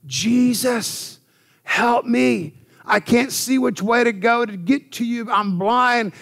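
hollering for help. Retold or imitated. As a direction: cry out like the blind man on the road. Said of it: "he just hollered out".

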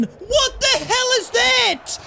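high, loud, slowly stretched shout; exasperated shout. Real, not acted. Gamer yelling at his team's botched cross, high slow shout. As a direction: yell it slow at the screen. Another chance wasted.

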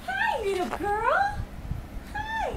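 high, slow sweet hello. Real, not acted. Greeting a toddler on video call.

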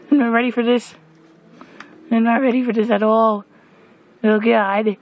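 nervous panic at monsters; panicked. Real, not acted. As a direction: sound overwhelmed.

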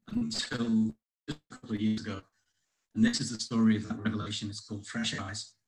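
ordinary delivery

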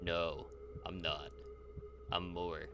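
low, soft, halting creature reply. Acted. Creature denying it is a parasite, low measured voice. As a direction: intone it low with pauses between phrases.

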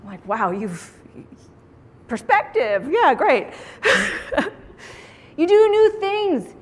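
normal talking voice

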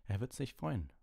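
coolly. A narrator's reading. Lupin answering Hermine about Snape, coolly. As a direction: say it with cool restraint. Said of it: "sagte Lupin kühl".

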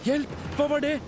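very high, alarmed cry for help; frightened high-pitched call; startled shout. A narrator's reading. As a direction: yelp as if suddenly grabbed. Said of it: "ropte Theodor".

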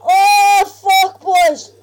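loud, high panicked warning; urgent warning shout. Real, not acted. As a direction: yell to save your mate. Warning teammate of zombies.

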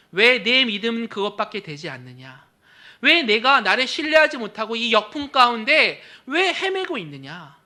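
loud scolding questions; mock rebuke. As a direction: scold a weak disciple. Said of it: "라고 질책하지 않으셨어요". (질책하는 듯 큰소리로 꾸짖으며)

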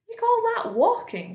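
high, incredulous centipede; centipede's indignant cry. A narrator's reading. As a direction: cry it out high in mock disbelief. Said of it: "cried the centipede".

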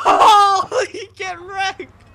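shrieking with laughter; laughing shout. Real, not acted. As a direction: howl it through laughter. Laughing at friend getting hit.

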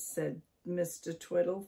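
usual speaking voice

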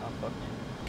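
usual speaking voice

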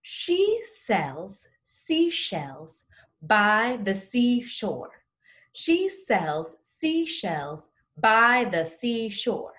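"She sells seashells" stretched out word by word; slow drill read. Shown as a demonstration. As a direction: spell out every sibilant slowly.

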